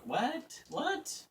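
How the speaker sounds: high, sharply rising questions; squeaky mock disbelief; mock bafflement. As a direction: play a confused reaction, voice jumping up. Acted baffled reply to the clatter.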